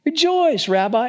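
high-pitched, gushing hail; hammed-up joyful welcome; effusive greeting. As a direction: greet him with showy delight, pitch way up. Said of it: "in the Greek is rejoice".

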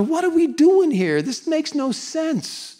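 high whiny mutter, slowing; whiny grumbling. Retold or imitated. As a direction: grumble to each other like bored marchers. Israelites chatting, complaining.

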